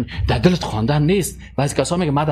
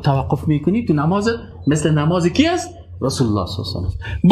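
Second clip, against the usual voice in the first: ordinary delivery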